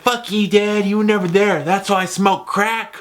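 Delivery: high-pitched mock angry kid; Mikey. Son telling off his absent dad, mock outrage. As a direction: whine it as an aggrieved son, high. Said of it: "Mikey's like".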